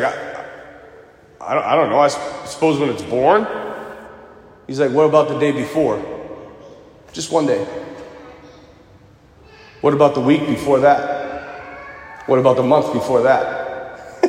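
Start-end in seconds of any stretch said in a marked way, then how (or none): 0.0-3.5 low, halting, stumbling reply; retold unsure answer. Lineman cornered by a question, hesitant low answer. 4.9-14.2 low, measured questioning; calmly walk someone back step by step; retold probing questions. Teammate reasoning with lineman, low patient probing.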